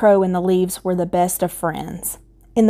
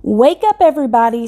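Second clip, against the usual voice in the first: calling out; 5 semitones higher, 4 dB louder, faster than usual. Acted. Scarecrow calling friends.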